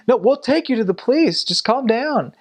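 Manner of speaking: high-pitched, hurried reassurance; urgent placating. Retold or imitated. Missionary offering to take man to police, urgent placating. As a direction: try to settle a hysterical man.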